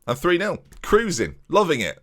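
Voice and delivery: ordinary delivery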